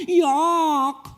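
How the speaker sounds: high, loud mock disgust; mock-grossed-out "Yuck!". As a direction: squeal like a spoiled rich kid. Rich friend fed poor food.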